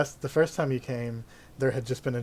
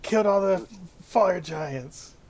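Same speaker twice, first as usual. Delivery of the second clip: high, excited guard voice; excited dwarf guard; 8 semitones higher, 7 dB louder than usual. Acted. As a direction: gasp in awe at the heroes. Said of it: "And the one's like".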